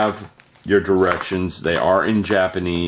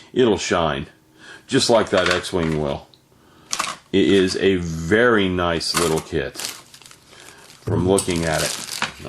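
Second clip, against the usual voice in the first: ordinary talk, nothing marked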